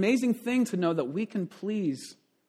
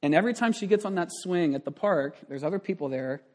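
ordinary talk

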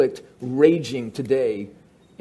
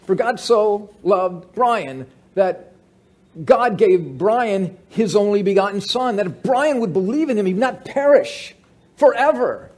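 fervent personalized scripture; 6 semitones higher, 5 dB louder than usual. Retold or imitated.

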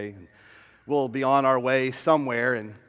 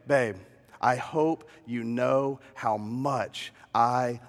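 slow, soft and tender, a little lower; tender words to son; 4 semitones lower, 3 dB quieter, slower than usual. Retold or imitated. Father whispering love to his son, slow and tender. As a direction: hold him close and tell him gently you love him. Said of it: "I'm trying to impart to him".

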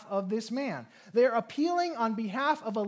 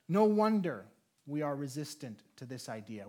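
ordinary talk

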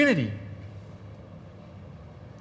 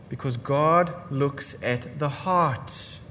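quiet solemn; 6 semitones lower, 7 dB quieter, slower than usual. Real, not acted. Solemn point.

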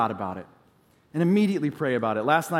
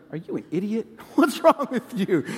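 wife's voice, higher and laughing; hammed-up incredulous wife; mock disbelief; 5 semitones higher than usual. Wife staring at him over the plate, mock disbelief. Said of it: "she looked at me like".

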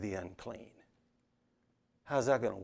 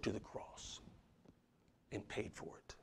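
dropping to a much softer whisper; 3 semitones lower, 16 dB quieter than usual. Acted. Pastor speaking of Christ's sacrifice, hushed whisper. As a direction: let it fall away to breath. Solemn hushed close to congregation.

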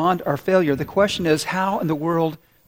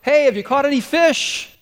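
high-pitched yell from the shore; calling out; 8 semitones higher, 4 dB louder than usual. Retold or imitated. Shadowy figure yells to fishermen from the shore, calling out. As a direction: yell it out to someone far off. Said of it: "he yells out".

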